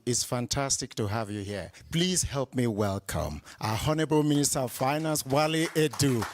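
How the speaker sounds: usual speaking voice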